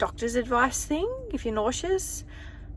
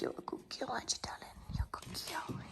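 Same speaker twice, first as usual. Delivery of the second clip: whispered praise; 4 semitones higher, 15 dB quieter, faster than usual. Real, not acted. Whispers praise to her good girl dog. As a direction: hush it to the dog like a secret.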